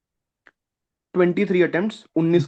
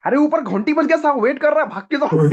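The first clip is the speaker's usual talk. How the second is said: high, hurried imitation of a fellow candidate hurrying him along; urgent call upstairs; 8 semitones higher than usual. Candidate tells him the bell rang upstairs, hurried high voice.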